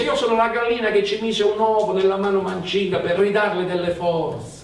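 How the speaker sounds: più acuta e più forte, veloce; gallina che parla. A narrator's reading. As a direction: fai la gallina, svelta e squillante. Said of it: "la gallina disse". (la gallina bianca si presenta a Zosimo, acuta e rapida)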